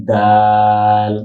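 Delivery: দাল with pressed d and very long aa; pronunciation demo. Shown as a demonstration. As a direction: model দাল slowly, holding the vowel. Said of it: "মিশিয়ে চাপ দিয়ে".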